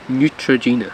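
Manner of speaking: NEUTROGENA as "new-truh-JEE-nuh"; English pronunciation. Shown as a demonstration. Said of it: "I'm gonna say it in English".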